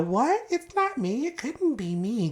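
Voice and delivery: high-pitched mock-innocent denial; playing dumb. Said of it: "She likes to play the".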